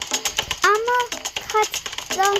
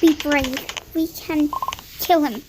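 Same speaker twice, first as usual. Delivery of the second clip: ordinary talk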